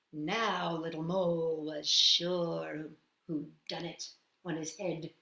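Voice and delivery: ordinary delivery, nothing marked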